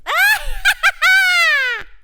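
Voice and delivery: very high, loud shrieking cackle; shrieking laugh. A narrator's reading. Monkey or bear bursts into a shrieking, shouted laugh. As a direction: let out a wild screeching laugh. Laugh after the bear's line.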